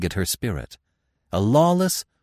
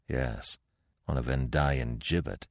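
low, dry hill chief; 6 semitones lower, 7 dB quieter than usual. A narrator's reading. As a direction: scoff at the idea. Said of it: "chief mocking her plan".

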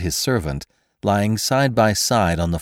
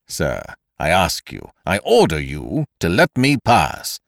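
firm demand. A narrator's reading. Kesselbach ordering the intruder aside, firm demand. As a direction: insist on being let through. Cornered man confronts intruder.

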